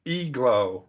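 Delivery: IGLOO drawn out as "EEG-loo"; pronounced like E. Shown as a demonstration. Teacher correcting the learner, IGLOO begins like an E. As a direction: slowly say IGLOO with an E sound first. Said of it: "it's pronounced like an E".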